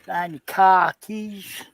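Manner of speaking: slow, drawn-out mock Boston accent. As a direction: stretch it out in a put-on Boston accent. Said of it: "Let's hear your best Boston accent".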